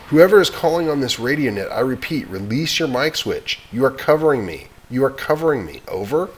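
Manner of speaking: crisp official radio call; radio operator voice. Acted. Operator telling the caller to release the switch, brisk.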